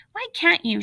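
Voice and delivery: high-pitched, insistent question; demanding. A narrator's reading. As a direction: press for an answer, pitch jumping up. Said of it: "she demanded".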